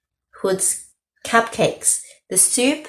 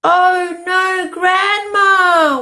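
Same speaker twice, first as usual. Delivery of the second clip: high, louder wailing cry; wailed; 12 semitones higher, 5 dB louder, much slower than usual. A narrator's reading. Girl wailing for her grandma. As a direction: wail for her lost grandma.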